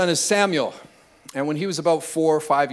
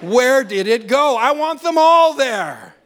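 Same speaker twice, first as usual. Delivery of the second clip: higher, louder sermon climax; full-force preaching; 8 semitones higher, 4 dB louder than usual. Real, not acted. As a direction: preach it out with rising fervour.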